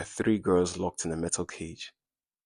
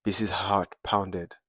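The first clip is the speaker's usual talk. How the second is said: ordinary delivery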